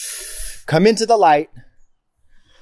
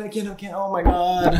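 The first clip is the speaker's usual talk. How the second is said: scared; 3 semitones higher, 6 dB quieter than usual. Real, not acted. Too scared to film bug.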